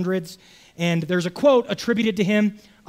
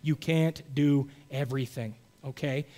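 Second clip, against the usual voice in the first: softer, lower, pleading; soft earnest repeat; 5 semitones lower, 6 dB quieter than usual. Shown as a demonstration. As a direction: say it gently so it sinks in. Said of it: "Please listen to me".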